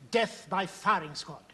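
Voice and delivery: ordinary delivery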